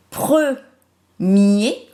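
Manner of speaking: "premier" slow and emphatic, pre, mier; split into syllables. Shown as a demonstration. Teacher correcting with pupils, "premier" syllable by syllable. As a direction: say "premier" in two held parts with a pause between. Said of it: "on l'entend sur la syllabe mier".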